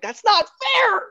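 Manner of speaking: high, slow whiny complaint; whiny protest. Retold or imitated. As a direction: drag it out in a high whine. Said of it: "I'm like".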